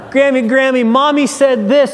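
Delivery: put-on high, whiny little-kid voice with a laugh; toddler tattling. A toddler runs to grandma, complaining in a high child's voice.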